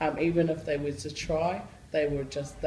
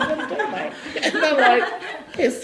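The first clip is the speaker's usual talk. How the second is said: laughing; 10 semitones higher, 8 dB louder than usual. Real, not acted. Laughing, kids cheer.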